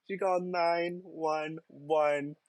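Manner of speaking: drawn-out stunned repeat. Shown as a demonstration. Repeating the caller's outrage slowly, in disbelief. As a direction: stretch it out in disbelief.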